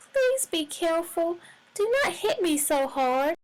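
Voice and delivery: tiny high-pitched voice begging for mercy; wee little pleading voice. A narrator's reading. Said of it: "a wee little voice say in a pleading".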